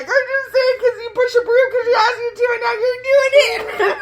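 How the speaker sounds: high, loud, fast laughing rant; laughing and crying. Retold or imitated. Teasing Bill about the broom, laughing hard. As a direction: blurt it out while cracking up. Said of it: "I was cracking up crying".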